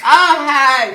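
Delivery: high, very loud hello; loud greeting. Retold or imitated. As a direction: blurt a greeting out to the whole room. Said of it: "I'm just always like".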